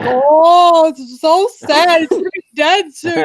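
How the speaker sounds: high, drawn-out mock pity; slow whimpering fake sadness; mock sorrow. Mock sad inner reaction.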